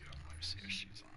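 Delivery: breathy whispered aside to himself. Real, not acted. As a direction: whisper it so no one hears. Checking quietly mid-game.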